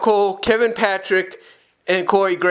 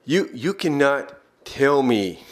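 ordinary talk, nothing marked

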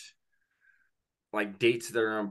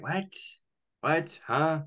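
imitating puzzled coworkers, higher pitch; mock bewildered coworkers, short questions; puzzled group reaction, acted out; baffled reaction; 5 semitones higher, slower than usual. Coworkers react to bold prediction.

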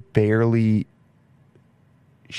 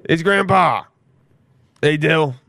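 put-on higher old New York Italian grandpa voice; imitating grandpa; 5 semitones higher, 3 dB louder, slower than usual. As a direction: put on his grandpa's greeting. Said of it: "New York Italian grandpa voice ever".

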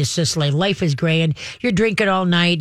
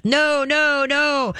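high, sing-song nagging; nagging owner; 9 semitones higher, much slower than usual. Retold or imitated. Trainer mimics owners saying no to death, high nagging. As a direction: pitch it way up like an owner nagging the dog. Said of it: "Most dogs are no to death".

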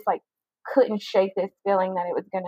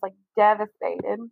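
ordinary delivery

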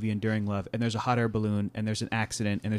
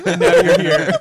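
laughing; 9 dB louder than usual. Real, not acted. Host cracking up mid-sentence.

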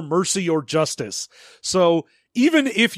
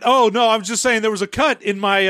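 normal talking voice

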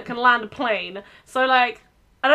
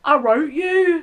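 slow, drawn-out Bromley impression; Bromley accent; 2 semitones higher, slower than usual. Retelling a dubbed film line to friends, exaggerated Bromley accent. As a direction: draw the words out in a comic Bromley accent. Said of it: "I think the Bromley one is".

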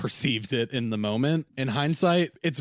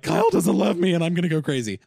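a touch of mock hysteria; 7 semitones higher, 7 dB louder, faster than usual. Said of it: "being like".

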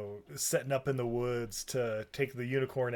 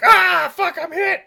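high, loud comic scream; hammed-up panicked yell; mock screaming; 13 dB louder than usual. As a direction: shriek it like a wounded runaway. Acts the darted unicorn fleeing.

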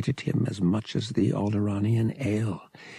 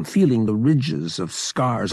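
ordinary delivery, nothing marked

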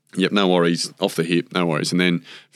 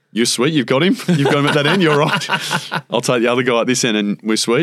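higher, louder, laughing through the pep talk; excited reassurance; 7 semitones higher, 3 dB louder than usual. Retold or imitated. Teammate hyping up Hughesy that he's got the bowler, excited and laughing. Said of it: "I said".

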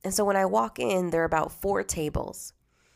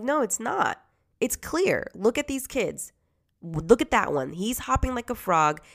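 indignant; 2 semitones higher, 4 dB louder than usual. Retold or imitated. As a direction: push back hard, voice up. Confronting director, indignant.

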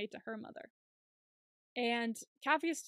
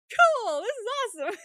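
much higher, louder, gushing delight; excited squeal; 12 semitones higher, 11 dB louder, faster than usual. Retold or imitated. Host imitating Capheus delighted at a new place, squealing. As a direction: squeal it like a thrilled kid.

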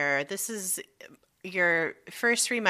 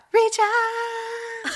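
high squeaky voice; 13 semitones higher than usual. Real, not acted. Repeated sign-off, squeaky.

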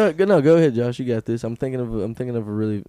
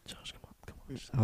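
urgent hushed prompt; whispering; 14 dB quieter than usual. Real, not acted.

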